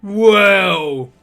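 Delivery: loud, high startled ogre. Acted. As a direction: ogre caught off guard, blurting. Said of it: "the big ogre is like".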